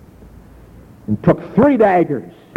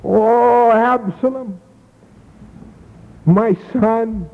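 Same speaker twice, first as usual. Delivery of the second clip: slow, drawn-out grieving cry of a father; a father mourning his dead son, mild sobbing lament; weeping lament; 8 semitones higher, much slower than usual. A narrator's reading. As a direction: let the grief pull each word out slowly. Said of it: "and wept".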